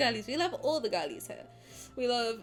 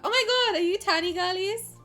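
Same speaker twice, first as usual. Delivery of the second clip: excited; 9 semitones higher, 5 dB louder than usual. Real, not acted. Delighted at chat fans.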